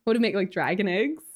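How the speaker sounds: normal talking voice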